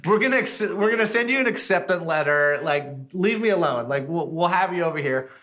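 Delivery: high, loud fed-up brush-off; exasperated chef. Retold or imitated. French chef telling the caller to leave him alone, high and loud, exasperated. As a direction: get rid of a pest on the phone. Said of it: "So he basically".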